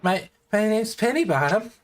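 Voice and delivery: high-pitched halfling introducing himself; halfling shopkeeper. Acted. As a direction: introduce yourself in a high little voice. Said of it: "halfling gentleman kinda pops out".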